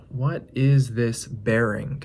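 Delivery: ordinary delivery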